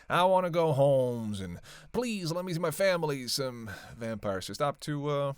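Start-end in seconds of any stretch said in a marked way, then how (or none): 0.1-1.4 loud, drawn-out mocking whine; mock whining. Vampire imitating reluctant human captives, mock whining. 1.9-3.3 high-pitched mock pleading; hammed-up whiny begging; mock whining. Vampire imitating captives begging to leave, mock whining. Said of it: "deal with all the whiny".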